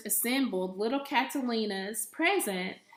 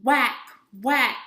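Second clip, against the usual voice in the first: loud whacks; 8 dB louder than usual. Acted. Piñata being hit.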